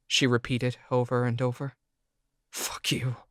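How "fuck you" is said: soft whispered curse; grumbled curse. Acted. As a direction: mutter it under your breath. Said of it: "I grumbled".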